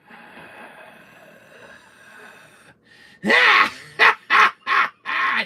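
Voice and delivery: long high loud laughter. Real, not acted. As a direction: burst out laughing.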